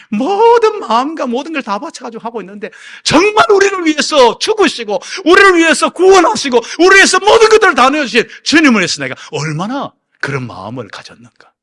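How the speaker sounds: high, loud impassioned sermon; fiery preaching. Acted. Pastor rebukes the congregation with fiery preaching. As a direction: pour everything into the rebuke.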